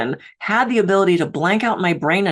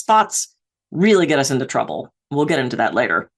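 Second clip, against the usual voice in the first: usual speaking voice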